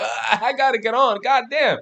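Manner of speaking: high, loud burst of buying fever; excited. Retold or imitated. Trader recalling his own hype over a green candle, excited. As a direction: blurt it like you just spotted a huge green candle. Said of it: "I get so fucking excited".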